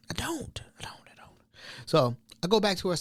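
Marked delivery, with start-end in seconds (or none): 0.1-1.4 fading whisper. Retold or imitated.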